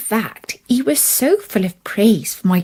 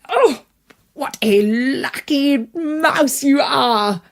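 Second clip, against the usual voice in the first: delighted exclaim; 5 semitones higher, slower than usual. Acted. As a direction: gush with delight at his luck. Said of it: "he exclaimed".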